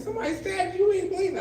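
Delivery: high, lightly voiced children; lightly voiced kid disbelief; doubtful kids. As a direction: question him like a skeptical kid.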